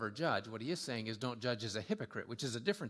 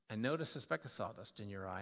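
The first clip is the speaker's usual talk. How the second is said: lower, gently acted confession; humble, gentle; 5 semitones lower, 4 dB quieter, faster than usual. Humbled man approaching his buddy, gentle. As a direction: ease into it, low and careful.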